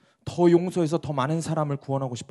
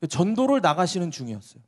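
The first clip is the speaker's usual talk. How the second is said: normal talking voice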